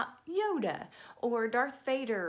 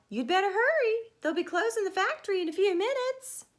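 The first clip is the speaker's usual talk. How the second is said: much higher and louder nagging reminder; wife's urgent voice; 9 semitones higher, 5 dB louder than usual. Acted. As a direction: hurry him along like Tony's wife. Wife urging Tony.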